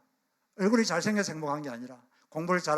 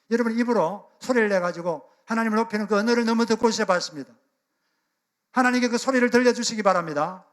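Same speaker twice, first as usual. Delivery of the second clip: louder, higher preaching push; fervent urging; 5 semitones higher, 5 dB louder than usual. Real, not acted. Pastor urging the congregation to voice praise, fervent louder preaching. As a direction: drive the appeal home to the congregation.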